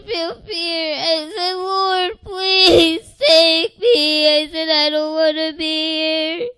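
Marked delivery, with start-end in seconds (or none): 1.5-4.3 high, loud, slow crying plea; tearful plea. Retold or imitated. Child begging the Lord to take her, crying. Pleading with Jesus. 4.7-6.5 tearful plea. Retold or imitated.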